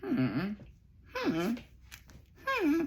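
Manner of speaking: spaced-out little hums, as if sizing someone up; imitated pondering hum. Mimicking the date who just stares, soft questioning hums. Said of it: "he like this. He like".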